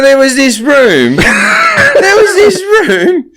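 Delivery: laughing high, words squeezed out slowly. Real, not acted. Comic telling the spy bar story, laughing. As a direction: fight through a laughing fit.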